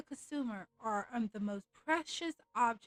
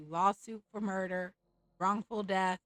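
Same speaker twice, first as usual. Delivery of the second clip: ordinary talk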